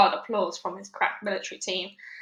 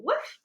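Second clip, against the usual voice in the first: dog bark. Acted. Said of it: "Yuri barked his approval".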